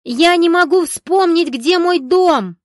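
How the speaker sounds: high, loud, slow panicked shout; panicked scream. A narrator's reading. Valeria at the bus stop, screaming in panic. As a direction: cry out in desperation. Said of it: "вскочила на ноги и закричала".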